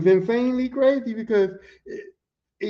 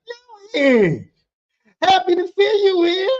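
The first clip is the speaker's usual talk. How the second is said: overjoyed greeting; 11 semitones higher, 7 dB louder, slower than usual. Retold or imitated. Greeting a friend on the job.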